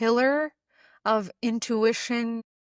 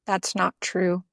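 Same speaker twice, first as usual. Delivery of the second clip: slightly lowered, measured voice of reason; deep inner voice; 2 semitones lower than usual. Acted. Intuition answering the inner saboteur, lightly deepened and measured. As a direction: lightly voice a steady inner knowing, with long pauses. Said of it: "the deep voice would come in and go".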